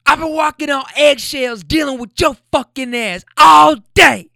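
high, loud mock shriek; retold full-volume yell; imitating her screaming. Woman screaming at her boyfriend over groceries, high and loud. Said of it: "And she goes".

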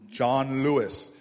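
very low, slow, loud John Lewis; grand dramatic reveal voice; deep booming announcer. Retold or imitated. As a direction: boom the name like a movie trailer. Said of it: "And I'm like".

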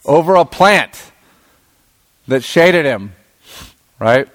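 ordinary talk, nothing marked

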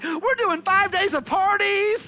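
cheerful office coworker. Retold or imitated. Coworker tempting a dieter with party food, bubbly and loud. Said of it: "the office is like".

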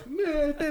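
squeaky soft whine; high whiny meh. Pretend. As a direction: whimper at the dog for the washing. Tiny human pointing, whining.

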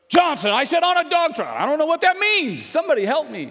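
mock boss yell, high and loud; exasperated yell. Boss yelling across job site.

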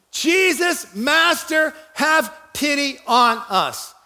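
high, drawn-out acted plea; calling out. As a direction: cry for mercy from afar, slowing at the end. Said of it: "called out to Jesus that way".

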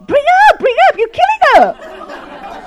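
very high, loud shriek, stretched out; mock panicked shout. Wife watching the baptism, shrieked comic panic. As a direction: yell in hammed-up alarm, laughing. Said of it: "and I said".

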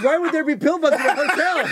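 high, loud, laughing disbelief; laughing as he mimics the baffled hotel guy; incredulous hotel guy. Retelling the Peoria hotel guy's disbelief, high and amused. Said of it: "He goes".

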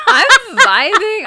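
laughing. Real, not acted. Joking about vibing while mom stresses.

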